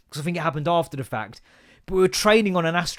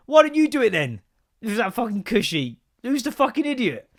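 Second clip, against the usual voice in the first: higher-pitched exasperated comeback; indignant challenge; 4 semitones higher than usual. Acted. Teacher answers online critics, indignant. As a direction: throw it back at the critics.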